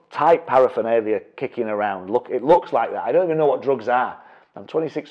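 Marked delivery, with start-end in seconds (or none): none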